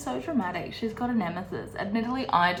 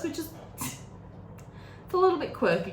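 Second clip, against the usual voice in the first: breathy laugh through the words, higher; 8 semitones higher, 4 dB quieter than usual. Real, not acted. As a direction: giggle your way through the line. Laughing at quirky Kate.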